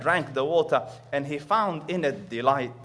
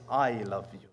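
hushed, tender and low; a quiet divine declaration of love; soft reverent; 5 semitones lower than usual. Shown as a demonstration. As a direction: let it land softly, full of awe. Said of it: "But Allah Rabbul Izzah says".

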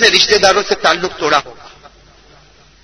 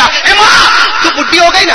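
high, raised-voice disrespectful son; rude shouting; 9 semitones higher, 4 dB louder than usual. Acted. Young man talking back to his mother, acted rude shout. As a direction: call out harshly, dismissing an old mother.

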